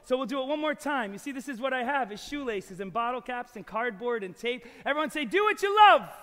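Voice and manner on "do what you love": loud, rising cheer to lead the chant; rallying call. Real, not acted. Performer leading the audience in a loud chant. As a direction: pump up the crowd.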